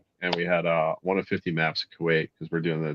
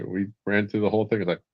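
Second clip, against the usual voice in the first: ordinary delivery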